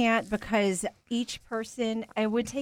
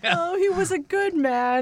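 mock eulogy; 8 semitones higher, 3 dB louder than usual. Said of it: "You're gonna eulogy?".

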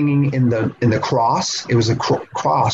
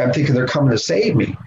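ordinary talk, nothing marked

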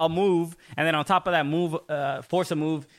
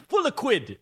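put-on English accent; 6 semitones higher than usual. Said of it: "Is that what they say".

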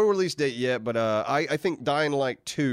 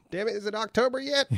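high, hammed-up impatient whine; mock impatience; 12 semitones higher than usual. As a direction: whine way up high like a kid who can't wait. Impatient for Red Dead release.

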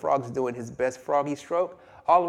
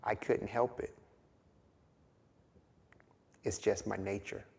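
much softer, resigned admission; a low, soft shrug of a confession; quiet resigned scorpion; 4 semitones lower, 9 dB quieter than usual. Acted. Scorpion quietly admits it to the sinking frog. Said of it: "The scorpion's response right before they go under".

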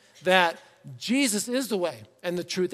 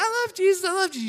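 high-pitched, eager kid imitation; little child; 12 semitones higher, 4 dB louder, faster than usual. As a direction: squeal it like an excited little kid. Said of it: "as a little child".

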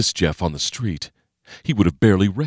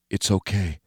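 gentle reassurance; 5 dB quieter than usual. Acted.

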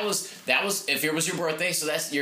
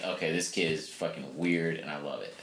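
lower, softer voiced friend reaction; lightly voiced Dibs; 9 semitones lower, 5 dB quieter than usual. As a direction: drop low as the amused friend. Voicing Dibs's reaction.

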